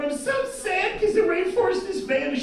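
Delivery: high, whiny mock girlfriend voice; high mock whine. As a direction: pout it out like a sad vegan.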